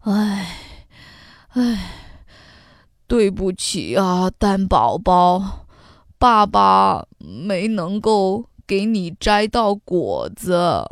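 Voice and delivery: low, sighing apology; dejected father. A narrator's reading. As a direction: sigh it like a defeated dad. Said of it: "垂头丧气地". (垂头丧气的低沉爸爸)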